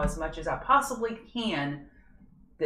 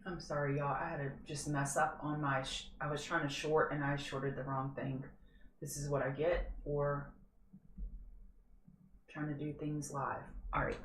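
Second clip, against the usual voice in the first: much softer, sheepish running apology; quiet apology; 2 semitones lower, 11 dB quieter than usual. Real, not acted. Streamer apologizing quietly to viewers for a wrong trade.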